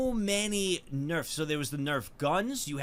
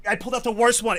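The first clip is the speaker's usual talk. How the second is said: higher and louder, leaning on worst; loud dismayed repeat; 6 semitones higher, 8 dB louder, faster than usual. Real, not acted. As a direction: blurt it out, still freaked. Repeats after the scream.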